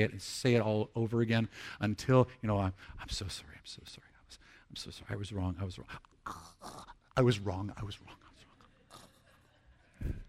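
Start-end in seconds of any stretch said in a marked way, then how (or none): 2.6-10.1 low, hushed rehearsal fading into whispers; muttered repetition. Acted. Person practising an apology alone in the backyard, muttering. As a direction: repeat it under your breath, over and over.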